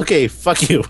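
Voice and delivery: ordinary talk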